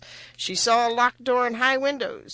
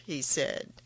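ordinary delivery, nothing marked

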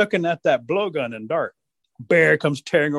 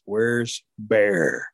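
low, drawn-out question; ominously low rabbit; ready hunter; 6 semitones lower than usual. Acted. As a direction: drop it low and draw it out. Rabbit ready to hunt Bear.

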